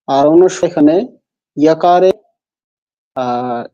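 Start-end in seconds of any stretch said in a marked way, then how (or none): none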